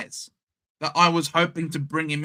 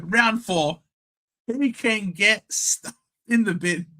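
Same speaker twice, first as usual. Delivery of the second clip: normal talking voice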